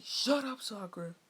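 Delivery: loud high snap at Sakura; Sasuke yells at Sakura; angry shut up. Retold or imitated. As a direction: bark it to shut her up. Narrator voices Sasuke snapping.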